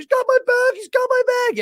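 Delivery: high, loud panicked yell imitating him; mock-frantic high-pitched shouting; yelling and screaming. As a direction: scream it like you're being robbed. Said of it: "you start yelling and screaming".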